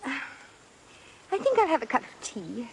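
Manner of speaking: put-on posh English accent, slow and careful; an American girl faking upper-class British; fake British accent. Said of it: "your accent".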